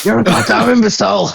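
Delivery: mock yell of an exasperated father; movie quote. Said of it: "parts of it ... I just quote".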